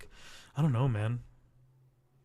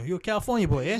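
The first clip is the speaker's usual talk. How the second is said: higher, louder New York street voice teasing a Californian; mock New York accent; 6 semitones higher, 5 dB louder, much faster than usual. New Yorker teasing the California boy, loud mock New York accent. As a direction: play a New Yorker calling out a tourist. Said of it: "they spotted me from a mile away".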